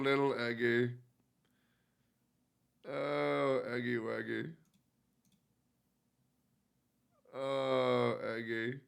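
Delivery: whisper-soft love for the cat; tender coo. Real, not acted. Softly cooing at cat.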